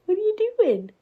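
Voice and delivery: high, loud squeak to a cat; high pet-talk voice. Real, not acted. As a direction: pitch way up for the pet. Talking to the cat.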